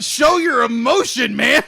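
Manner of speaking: slow, shouted director's plea; shouting. Pretend. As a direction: yell it slowly like a frustrated director. Mocking wooden acting, urging emotion.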